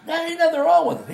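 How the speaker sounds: high-pitched, dismissive quote of another guy; mock reassurance. Retold guy insisting cake is fine.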